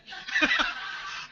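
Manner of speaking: bursts into a high, loud laugh. Real, not acted. Laughing at the hospital mix-up.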